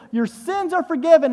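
loud, ringing forgiveness; emphatic Jesus quote. Retold or imitated. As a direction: proclaim the pardon with force. Jesus's words quoted with force.

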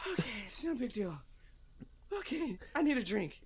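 high, strained self-soothing; forced calm. Retold or imitated. Man sitting down after losing it, high forced calm. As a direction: talk yourself down, barely holding it. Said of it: "I sit down and I'm like".

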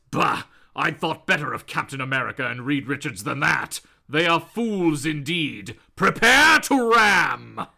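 low, scornful villain building to a command; Doom. Acted. Villain ordering an attack on fools, grandiose scorn. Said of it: "So Doom says".

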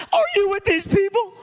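imitated wailing, very high and loud; tearful outburst. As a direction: burst like a broken dam, hammed-up. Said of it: "Like the dam just broke".

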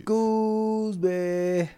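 one long, high held note; drawn-out name intro. Real, not acted. Co-host introducing himself to listeners, drawn-out high call. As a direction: stretch it out high and level.

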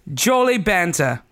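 slow mock English accent. Shown as a demonstration. Showing a co-host how to read it, English accent. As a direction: put on an English accent, drawing it out. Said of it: "that's how you would say that".

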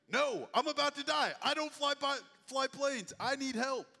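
loud, high-pitched frantic plea; hammed-up desperate shouting; acted panic. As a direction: cry out for help in a panic. Panicking passenger pleads over the radio.

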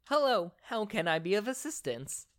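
high-pitched female AI assistant; female robotic voice. Acted. AI on the phone answers the caller, female robotic voice. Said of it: "this female robotic voice come through".

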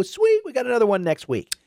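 high-pitched excited fan cheer; hyped up. Acted. Fan after seeing the team win, hyped up. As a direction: act out a thrilled fan, voice jumping high. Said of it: "you get hyped up".

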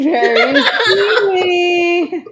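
laughing. Acted. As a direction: stretch very steamy slowly through a laugh.

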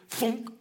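axe thunk; thunk sound effect. Shown as a demonstration. Preacher adds the axe sound, a short thunk. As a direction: say it like the axe hitting. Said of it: "I'm adding sound effects here".

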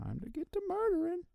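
very high, soft singsong; cartoonishly high little voice; mock gleeful villain. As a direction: pipe it up high and soft, playfully murderous.

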